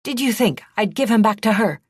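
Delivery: loud, high and sharp; angry man snapping at the princess. A narrator's reading. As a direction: snap it at her impatiently. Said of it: "he snapped".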